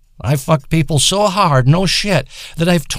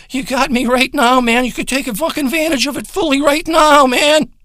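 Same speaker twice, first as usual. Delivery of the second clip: building excitement; 7 semitones higher than usual. Acted. Hyping himself up to viewers.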